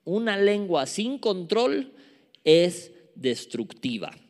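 ordinary talk, nothing marked

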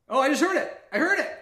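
sudden high, loud delight; blurting it out, thrilled; excited. Real, not acted. Finally hears the delayed audio.

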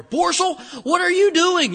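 high, loud yell with an R stuck in the name Borsel; boss's mispronunciation. Retold or imitated. Boss mangling the name to Borsel, yelling loud and high. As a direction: call out Borsel like the boss who always added an R. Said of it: "my boss could never say Boysel".

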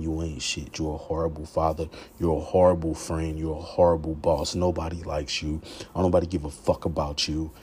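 low, hushed self-loathing voice; inner demon whispering. Acted. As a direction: murmur cruel judgments into your own ear. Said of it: "I can hear him whispering".

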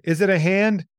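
high-pitched, nervous question; anxious question. Acted. Retelling Daryl asking with eyes closed, anxious and high. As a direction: quote it high, dreading the answer.

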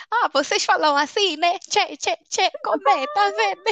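put-on high-pitched parody of an African accent; mock African accent. Host parodying people who mimic how Africans talk, mock African accent. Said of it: "E falando em sotaque".

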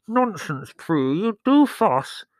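high, loud, mouth-blocked retort; slow, smothered protest through cabbage leaves; muffled by leaves. A narrator's reading. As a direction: talk as if the face is bound up. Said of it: "her voice muffled by cabbage leaves".

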